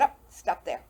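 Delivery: imitated cut-in, suddenly loud; loud interruption. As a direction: cut the speller off with a loud, playful halt. Funeral man cutting her spelling off.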